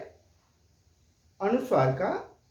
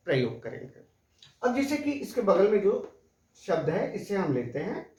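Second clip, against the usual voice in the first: ordinary talk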